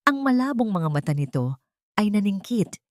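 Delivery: ordinary delivery, nothing marked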